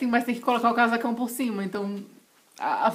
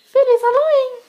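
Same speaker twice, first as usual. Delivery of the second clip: much higher, loud festive cheer; excited cheer; 11 dB louder than usual. Real, not acted. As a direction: shout the holiday greeting. Sign-off shout.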